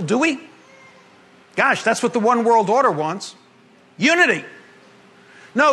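ordinary delivery

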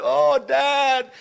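high, loud, drawn-out grovelling; exaggerated wail of self-abasement; mock begging. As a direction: plead like an unworthy crawler, hammed up. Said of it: "beg or crawl or scrape".

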